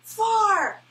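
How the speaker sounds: high squeaky puppet call; Sesame Street far. Retold or imitated. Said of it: "This reminds me of an old Sesame Street skit".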